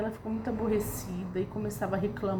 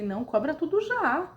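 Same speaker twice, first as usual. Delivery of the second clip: higher-pitched, irritated insistence; mildly exasperated, voice pitched up; retold irritation; 6 semitones higher than usual. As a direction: re-enact an annoyed demand. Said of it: "porque eu tava irritada".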